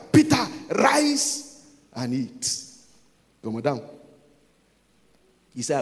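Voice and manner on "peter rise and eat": raised, drawn-out third command; voice from heaven insisting. Acted. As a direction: call it out higher and slower, insisting. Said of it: "The third time".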